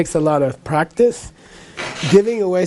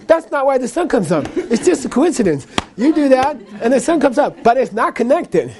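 higher-pitched, animated explaining; objecting Russian listener; 7 semitones higher than usual. Retold or imitated. Russian man explaining it is just coincidence, protesting. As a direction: reason with a fool in a raised voice. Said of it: "this Russian guy goes".

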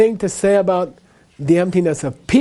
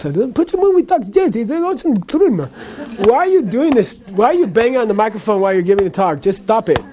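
animated, raised-pitch protest; exasperated Russian listener; 4 semitones higher than usual. Retold or imitated. Russian man objecting to banging on the microphone, exasperated. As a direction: act out an indignant heckler demanding an answer. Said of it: "this Russian guy goes".

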